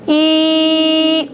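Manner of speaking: steady, drawn-out "E" sound, modelled for pupils; sustained long E. Shown as a demonstration. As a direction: hold "E" as one long, level vowel. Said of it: "as long as I hold my fingers there".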